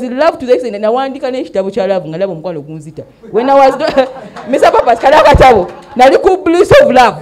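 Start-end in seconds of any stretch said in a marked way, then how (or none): 3.3-7.2 laughing giddy. Real, not acted. Laughing recalling her love book.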